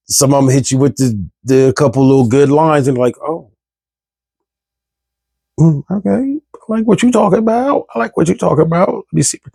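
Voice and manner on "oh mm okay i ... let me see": higher, lightly voiced flattered woman; charmed woman. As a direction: mimic a woman falling for smooth lines, a bit faster. Woman charmed by smooth pickup lines.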